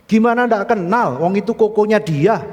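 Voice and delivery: loud, high-pitched indignant comeback; exasperated retort. Acted. Shop owner's wife answering the pastor's naive question, exasperated retort. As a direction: fire back as if it were obvious.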